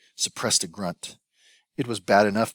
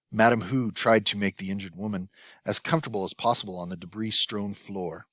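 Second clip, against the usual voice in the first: ordinary delivery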